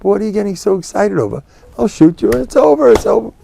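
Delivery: callous shrug. Retold or imitated. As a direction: wave away his panic.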